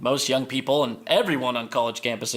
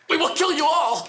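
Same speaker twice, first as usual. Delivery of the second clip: villain voice; 14 semitones higher, 4 dB louder than usual. Acted. Villain threat.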